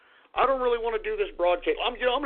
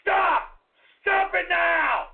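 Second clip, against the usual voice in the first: furious shout; 7 semitones higher, slower than usual. Acted. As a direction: bark each word slowly and angrily. Shouting stop at chat.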